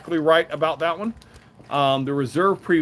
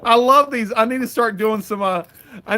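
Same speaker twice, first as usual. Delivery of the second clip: high, loud burst of delight; 9 semitones higher, 7 dB louder than usual. Real, not acted. Dad showing the fair hat to viewers, loud and delighted. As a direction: gush over the toy.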